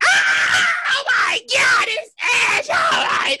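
high, hysterical fan squeal; wailing, overjoyed wrestling fan; screaming fanboy. Retold or imitated. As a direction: imitate a fan losing it at a surprise entrance. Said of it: "like I was a fanboy".

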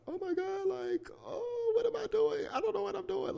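very high, whimpering mock panic; anxiety attack. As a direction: act out a tearful panic in a squeal. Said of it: "I just had all this fucking anxiety".

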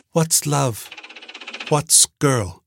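a computer baffled by human words; puzzled computer. A narrator's reading. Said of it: "asked Epicac".

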